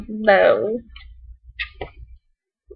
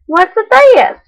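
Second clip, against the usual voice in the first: high, curious voice; a rabbit finding something new; 10 semitones higher, 5 dB louder than usual. Acted. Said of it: "He sniffed the mitten".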